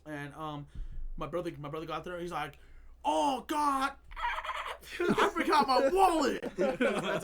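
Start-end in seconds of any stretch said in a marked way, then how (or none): 3.1-3.9 loud, high imitation of an angry outburst; retold outburst. Retelling brother's laundry outburst, mock exasperated. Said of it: "he started just cussing". 4.0-4.9 very high squeal standing in for curses; mock cussing noise. Telling brother's outburst, high mock cussing squeal. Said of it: "that's me cussing". 5.0-6.5 loud, high, slow mock wail; retold outburst. Retelling brother's laundry outburst, mock exasperated yell. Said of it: "he started just cussing".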